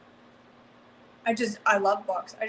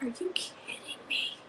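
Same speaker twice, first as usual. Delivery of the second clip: breathless disbelief; 4 semitones higher, 10 dB quieter than usual. Real, not acted. Reacting to Roma Downey news.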